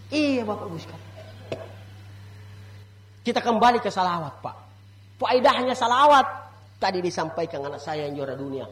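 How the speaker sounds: normal talking voice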